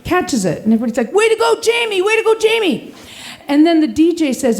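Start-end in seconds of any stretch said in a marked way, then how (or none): 1.1-2.9 crowd cheer. Retold or imitated. Crowd cheering the garter catch.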